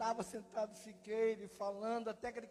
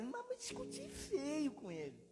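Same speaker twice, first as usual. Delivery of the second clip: tearful; 8 semitones higher than usual. Real, not acted. Lembrando discussões com Deus.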